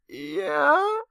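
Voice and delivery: sudden high squeak of a yeah; startled yeah. Retold or imitated. Asked by the boyfriend, squeaks a high startled yes. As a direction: caught off guard, voice jumps way up.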